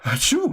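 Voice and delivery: high-pitched achoo into giddy laughter; hammed-up sneeze then squealing laughs; fake sneeze, gleeful cackle. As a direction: pretend delight after sneezing. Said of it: "This is Allah now".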